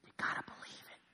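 hushed urgent whisper, almost no voice. Real, not acted. Preacher whispering to the congregation to believe. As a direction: lean in and breathe the words.

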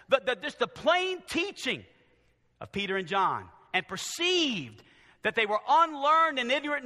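preacher declaiming scripture; forceful preaching. Real, not acted. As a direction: drive the verse home to the congregation.

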